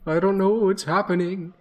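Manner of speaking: a baffled horse answering; frightened horse telling the druid it's lost; talking horse. Acted. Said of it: "The horse neighs and whinnies back and says".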